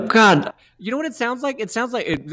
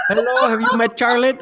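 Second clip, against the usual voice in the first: put-on higher, teasing grandma voice with an accent; mock Puerto Rican grandmother; 10 semitones higher than usual. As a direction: tease a friend by copying his odd accent. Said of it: "When did you become a Puerto Rican grandmother?".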